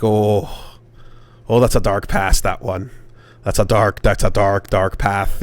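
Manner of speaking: low, grave warning from a Dublin stranger; ominous Dublin local. Retold or imitated. Dublin drinker warns a tourist about his family name, ominous. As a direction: drop low and dwell on the dark warning. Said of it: "got a pretty thick Irish accent".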